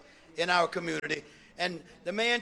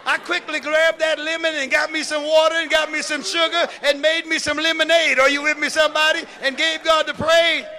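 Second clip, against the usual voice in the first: high, loud, building sermon chant; full-force preaching; 8 semitones higher, 11 dB louder than usual. Real, not acted. Bishop building praise to the congregation, full-force preaching. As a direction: preach it hard, each line hammered louder.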